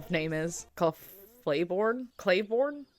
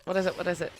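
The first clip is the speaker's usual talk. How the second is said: ordinary delivery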